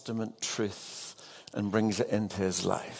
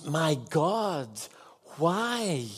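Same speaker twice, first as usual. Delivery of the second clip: higher, pained cry to God; anguished cry; 5 semitones higher, much slower than usual. Acted. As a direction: lift the voice in a pleading cry. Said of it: "He screams".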